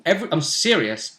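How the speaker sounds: ordinary delivery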